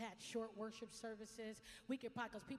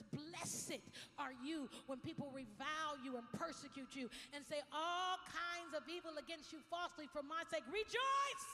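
building from scripture to shout; 5 semitones higher, 7 dB louder than usual. Acted. Pastor reading Matthew to the church, rising to a shout. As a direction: swell into the shout.

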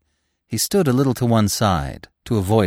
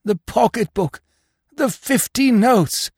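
high, drawn-out wail of loss; moaning; 12 semitones higher, slower than usual. A narrator's reading. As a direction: moan slowly in stricken disbelief, pitched high. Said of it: "he moaned".

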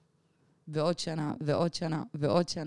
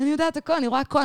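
high, wide-eyed "I know everything" rush; a thrilled higher voice, like seeing the universe open; awed excitement; 9 semitones higher, faster than usual. Retold or imitated. Retelling her trip to podcast friends, high and awed. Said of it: "ואני כזה מין".

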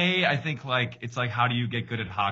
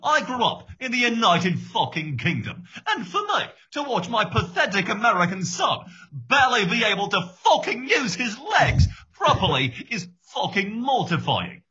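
high-pitched, frantic British coach rant; swearing British coach losing it over his son; John Oliver; 12 semitones higher, 3 dB louder than usual. Acted. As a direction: rant like a furious soccer dad from the UK. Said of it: "John Oliver coaching his kid's soccer team".

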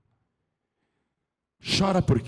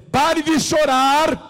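much higher, loud, slow command; commanding cry; 7 dB louder, slower than usual. Acted. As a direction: proclaim it with full authority. Jesus commanding, quoted. (ordem proclamada em alta voz)

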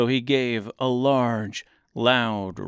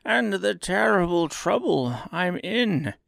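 high, slow self-pitying plea; pleading little man; 7 semitones higher, slower than usual. A narrator's reading. Oz fearing being found out, slow plea. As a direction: beg slowly for sympathy in a higher voice.

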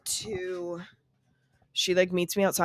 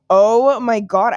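loud, slow, exasperated oh my God; exasperated groan; 3 semitones higher, 10 dB louder, slower than usual. Real, not acted. While hunting for a lost text, exasperated. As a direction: drag it out in real frustration.

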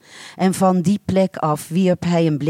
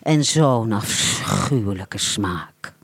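disgusted thought; 9 semitones lower, slower than usual. A narrator's reading. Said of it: "dacht ze nog wel".

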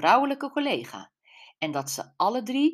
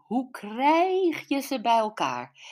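higher, slower astonished cry; astonished mother; 7 semitones higher, slower than usual. Acted. Mother amazed by daughter's gossip, exclaiming high and slow. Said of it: "slaakte een kreet van verbazing".

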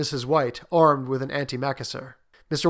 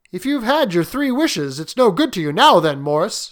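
put-on higher-pitched questioning old man; 6 semitones higher, 7 dB louder than usual. Old man challenges his soldier friend about keeping the paw, pointedly. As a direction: reason with a friend in a raised voice. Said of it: "said the old man at last".

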